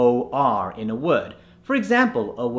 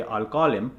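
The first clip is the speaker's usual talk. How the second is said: Indian accent; 4 dB quieter than usual. Shown as a demonstration. Coach warns of confusing American listeners, Indian accent. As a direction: call with the unconverted rounded vowel. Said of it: "because otherwise, if you say".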